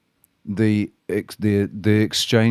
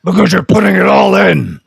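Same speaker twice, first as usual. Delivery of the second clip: excited shout; 10 semitones higher, 11 dB louder than usual. Real, not acted. Excited agreement about voice strain.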